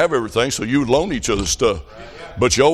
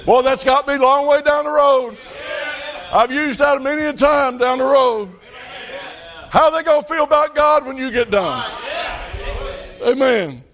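full-force preaching; 12 semitones higher than usual. Real, not acted. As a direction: lift it up and shout the lesson. Preaching climax.

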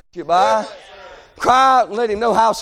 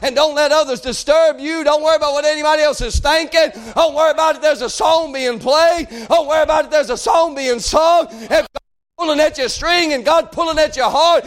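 raised, driving preacher's cadence; full-force preaching; 5 semitones higher than usual. Acted. Preacher urging the congregation to run to God, impassioned. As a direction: urge the congregation on without letting up.

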